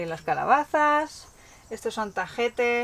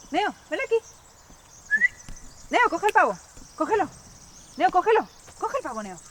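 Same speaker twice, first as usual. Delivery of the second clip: high-pitched calls to the dog; calling out to the dog; 9 semitones higher than usual. Real, not acted. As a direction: call the dog over, urgently. Calling the dog to chase turkey.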